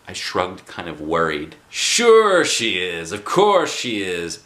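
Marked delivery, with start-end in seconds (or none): 1.7-4.4 put-on louder, slow confident old man; reassuring grandpa. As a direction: vouch for his granddaughter. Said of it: "said my grandpa".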